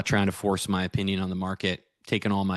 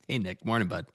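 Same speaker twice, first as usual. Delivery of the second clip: ordinary delivery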